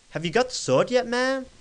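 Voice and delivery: put-on game character calling out in a dubious American accent. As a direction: ham up a strange American accent, pitched right up. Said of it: "I'm changing my voice. ... is it American?".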